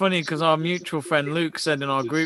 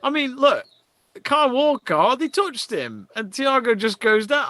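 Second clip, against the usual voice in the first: exasperated protest; 8 semitones higher than usual. Real, not acted. Disputing a foul on TV.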